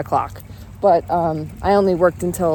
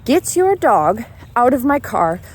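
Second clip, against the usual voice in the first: exasperated raised questioning; 5 semitones higher, 5 dB louder, slower than usual. Retold or imitated. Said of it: "I was like, very".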